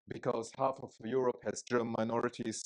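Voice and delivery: ordinary talk, nothing marked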